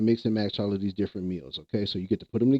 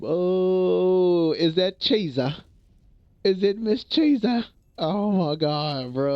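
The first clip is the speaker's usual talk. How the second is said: high, drawn-out delighted surprise; excited recognition; 9 semitones higher, much slower than usual. Real, not acted. Streamer spots a friend arriving in chat, excited recognition. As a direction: stretch the words out high, thrilled to spot someone.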